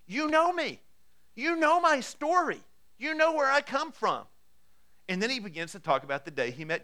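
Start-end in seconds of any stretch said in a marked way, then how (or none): 0.0-4.3 much higher and louder, falling hard on "know"; climactic appeal. Acted. Paul to the crowd that knows him, a soaring climactic plea. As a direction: hammer home that they all know him.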